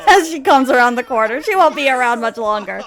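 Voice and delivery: loud, high, coming out of a laugh; amused outburst. Real, not acted. Teller amused as the queen rounds corner, loud laughing. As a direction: push it out through amusement.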